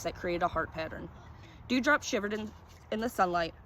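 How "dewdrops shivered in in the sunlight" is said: ordinary delivery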